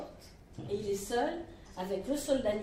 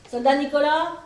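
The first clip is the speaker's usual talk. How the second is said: appel du sergent; 9 dB louder than usual. Acted. Sergent appelle les soldats.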